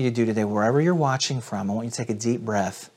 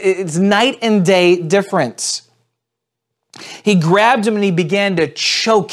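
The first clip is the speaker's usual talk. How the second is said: louder, driven reading of the parable; dramatic scripture reading; 5 semitones higher, 6 dB louder than usual. Acted. Pastor reading the parable to viewers, dramatic scripture reading. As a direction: read it aloud like a preacher building the story.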